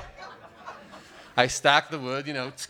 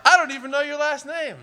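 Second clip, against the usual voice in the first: high, laughing, amazed gush; awed gushing; 13 semitones higher than usual. Retold or imitated. Comic quoting his awed reaction to her body, high laughing gush. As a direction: gush like a stunned, delighted guy. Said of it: "And I was like".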